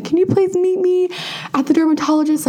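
high-pitched frantic panic, overdoing it; panicked high wail. Retold or imitated. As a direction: wail it like you're losing your mind over a bleeding mole. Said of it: "freaking out about what was going on".

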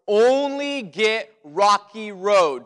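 ONLY drawn out slow and loud, each word weighed; firm stress on only. Retold or imitated. Dad to son before the errand, slow firm stress on Only. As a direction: hammer home the one rule slowly.

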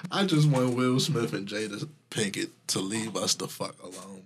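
ordinary talk

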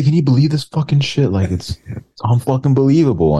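high-pitched feigned indignation; hammed-up incredulous complaint; mock mad. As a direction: get worked up in pretend disbelief. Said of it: "get, get mad".

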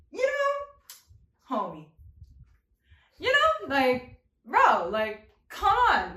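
squeaky high disbelief; high incredulous. Pretend. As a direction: pitch way up in disbelief. Scolding the three friends.

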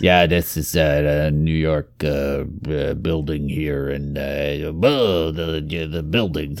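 low, soft, halting mock New York accent; bad New York accent. Cohost imitating a British actor's failed attempt, bad New York accent. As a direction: mumble through a badly faked New York accent. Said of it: "told to do a New York accent".

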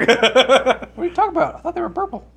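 high laughing disbelief; disbelieving. Real, not acted. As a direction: act shocked and giggle. Mock disbelieving retort.

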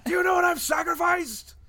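high, loud indignant yell; mock outraged shout. Co-host kicked off the podcast, in mock outrage.

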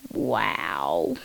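very low, drawn-out wow; mock-impressed guy. The guy answering the trains line, low mock-impressed voice. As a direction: drop the voice right down. Acting the guy's response.

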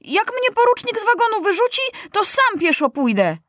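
put-on very high-pitched, defiant boy's outburst; indignant child, pitch shooting up; rebellious cry. A narrator's reading. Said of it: "krzyknął buntowniczo Maciuś".

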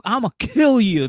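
high, loud mock yell; mock threat. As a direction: lunge into an exaggerated drunk threat. Acting out man held back, threatening.

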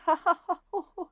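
high, hammed-up villain laugh; put-on mocking laughter, pitched high; mock gloating laugh. Gloating villain in retold scene.

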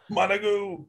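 Monugu called out high like a team cue; called trigger word. Shown as a demonstration. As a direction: sing out Monugu as the composure call. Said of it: "the trigger".

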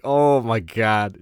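drawn-out, loud OH my God; slow, emphatic replay of disbelief; retold amazement. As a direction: stretch it out in mock astonishment. His own amazed reaction, retold.

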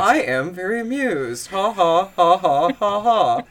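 lightly voiced robot assistant; hint of mechanical Alexa, mock amusement; robot impression. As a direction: fake a robot laugh in measured syllables. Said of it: "It just sounded like a robot".

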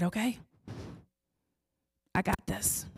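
a hint of a soft, quiet divine voice; calm voice of God. God reassuring the frightened mother, soft and calm. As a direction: answer gently, barely above a murmur. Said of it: "he said".

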